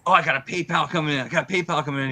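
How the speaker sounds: retold excited announcement; imitating excitement. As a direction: rush it out like an excited streamer. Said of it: "he got all excited".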